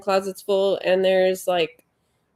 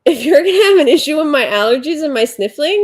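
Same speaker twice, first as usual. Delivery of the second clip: retort; 7 semitones higher, 5 dB louder than usual. Retold or imitated. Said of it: "And I'm like".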